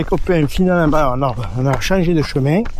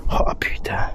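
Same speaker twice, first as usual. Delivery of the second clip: breathy under-the-breath swearing; whispered curse; 6 dB quieter, slower than usual. Real, not acted. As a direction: whisper it in pain. Painful scratch, quiet curse.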